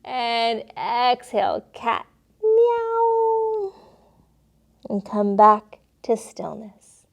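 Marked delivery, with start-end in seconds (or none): 2.4-3.7 high squeaky meow; cat meow. Pretend. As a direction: mew like a little kitten, way up high. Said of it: "making the noises".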